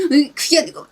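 high, loud exasperated outburst; flustered quarrel retort. Acted. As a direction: act out a spouse losing an argument, loudly. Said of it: "이런 스타일인 거예요".

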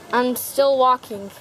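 usual speaking voice

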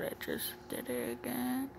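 ordinary delivery

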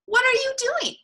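high beaver character voice; high-pitched cartoon beaver; curious Beaver. A narrator's reading. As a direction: ask it high, like an eager little animal. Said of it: "said Beaver".

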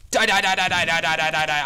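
loud repeated battle yell; shouting die. Real, not acted. Yelling at the mob he fights, loud. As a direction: hammer each die out loud.

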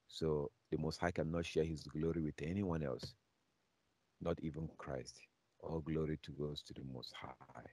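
usual speaking voice

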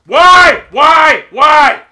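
high, very loud, drawn-out lament; despairing wail. Real, not acted. Gamer watching them come back, despairing shout. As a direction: wail it at full volume, pleading. Player in despair losing lead.

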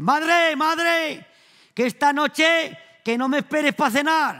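calling out loud. Retold or imitated. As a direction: shout it to his mother down the street. Child calls mother from afar. (llamando a gritos a su madre, más agudo y fuerte)

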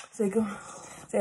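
loud, panting after holding the dog; out of breath. Real, not acted. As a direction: talk while catching your breath. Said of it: "Yeah, I'm out of breath".

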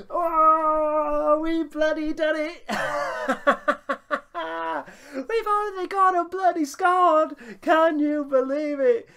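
high, loud, laughing yells of joy; ecstatic shouting. Real, not acted. Celebrating the winning goal for his team, shouting with joy. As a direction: shout it out, overjoyed and disbelieving.

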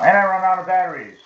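real frustration; exasperated outburst. Real, not acted. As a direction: blurt it, fed up. Batteries die mid game.

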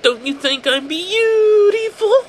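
high, loud, slow eerie plea; demanding madman. A narrator's reading. The son demanding his mother call him beautiful, slow and shrill. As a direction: stretch it out, pleading and threatening.

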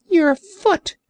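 high, scornful creature outburst; indignant Orc cry. Acted. Orc scoffing at the sailor, high indignant cry. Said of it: "cried the Orc".

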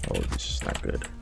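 breathed under the breath, unvoiced; worried whisper. Real, not acted. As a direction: whisper the worry to yourself. Worried gamer mutters to himself.

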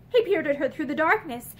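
plain reading voice

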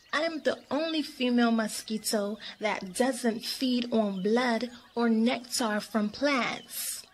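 ordinary delivery

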